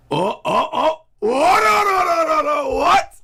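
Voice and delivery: high, loud shocked wail of no; a fan's horrified cry at the screen; rising panic, no no no. Real, not acted. Shocked reaction to the scene.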